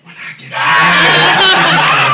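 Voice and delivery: high, loud, slow drawn-out yell; drawn-out shout. Retold or imitated. Brother on the jail phone yelling his plan, slow shout. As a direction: bellow it slowly, oblivious.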